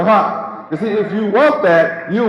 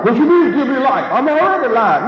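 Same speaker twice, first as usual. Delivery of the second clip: higher, incredulous voice of a sceptic; doubting listener; 7 semitones higher than usual. Acted. Preacher acting out a listener who doubts him, incredulous. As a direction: play the puzzled churchgoer pushing back.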